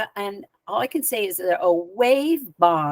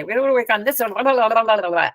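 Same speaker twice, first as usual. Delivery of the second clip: imitated droning medical jargon, words run together; babbled mock medicalese, blurring into blahs; spouting medicalese; 2 semitones higher, much faster than usual. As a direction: lightly mimic a robotic doctor rattling off jargon. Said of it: "starts spouting all this medicalese".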